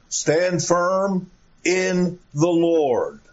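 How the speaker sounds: each word drawn out, FIRM leaned on; slow emphatic reading. Shown as a demonstration. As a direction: read it slowly to make it land. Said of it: "as I call attention to these expressions".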